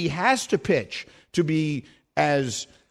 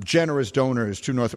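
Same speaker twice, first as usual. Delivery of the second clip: normal talking voice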